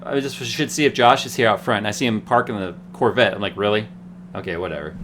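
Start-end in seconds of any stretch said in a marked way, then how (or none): 3.5-5.0 low, unimpressed mutter trailing off; retold dismissal. Seeing a friend parked in a Corvette, mildly dismissive. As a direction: shrug it off, dropping softer and lower.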